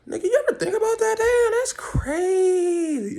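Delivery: squeaky awe, last word drawn way out; high-pitched amazement. Acted. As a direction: marvel in a very high, stretched voice. Mock amazement, aside follows.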